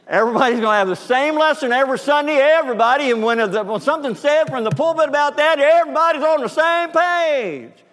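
high, loud, fast preaching cry; full-force preacher shout. Real, not acted. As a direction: bring the point home at full volume. Preacher drives point home loudly.

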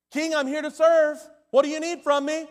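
eager loud pledge. Acted. Acted eager pledge to the king.

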